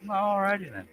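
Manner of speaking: high goofy "alrighty then"; silly put-on voice; weird voice. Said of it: "using a weird voice".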